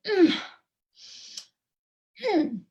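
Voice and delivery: "mm" falling hard from high, like mock anger; angry fourth tone. Shown as a demonstration. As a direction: drop "mm" down forcefully, as if angry. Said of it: "It's like you're angry".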